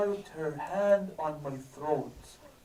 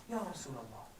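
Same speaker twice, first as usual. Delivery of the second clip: hushed plea; 2 semitones lower, 8 dB quieter, faster than usual. Retold or imitated. Begging the Prophet in the hospital.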